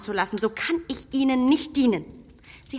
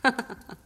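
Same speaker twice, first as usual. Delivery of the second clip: kurzes, abwinkendes Auflachen; kurzes Lachen; 3 dB quieter than usual. Acted. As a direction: ein leises, spöttisches Lachen. Emma lacht Vorwurf weg. (kurzes ungläubiges Lachen)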